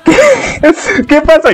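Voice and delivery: high squealing laugh, stunned; real shocked fangirl squeal. Real, not acted. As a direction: gasp it out way up high. Shocked by the push scene.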